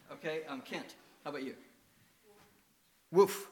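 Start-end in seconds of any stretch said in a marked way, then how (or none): 3.1-3.5 put-on child's woof. Child's dog sound.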